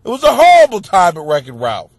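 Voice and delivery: usual speaking voice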